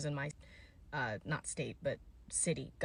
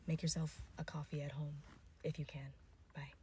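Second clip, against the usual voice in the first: whispered aside, voice dropped away; hushed little tip to viewers; 9 dB quieter than usual. Real, not acted. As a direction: lean in and whisper it.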